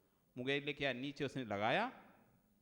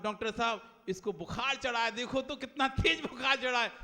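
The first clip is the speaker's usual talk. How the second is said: worried call; 7 semitones higher, 5 dB louder than usual. Acted. Mother calls, louder each time.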